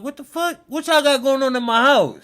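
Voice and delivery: a man alarmed at strangers in his house; retold alarm. Homeowner confronting noise downstairs, startled and high. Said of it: "I'm like".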